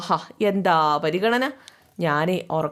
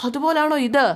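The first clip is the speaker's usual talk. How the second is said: sharp retort; 7 semitones higher, 3 dB louder than usual. Acted. Wife snaps back in quarrel.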